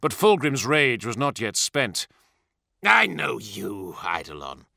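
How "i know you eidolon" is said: menacing. A narrator's reading. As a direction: let him know you see through him. Primarch's rage not yet spent.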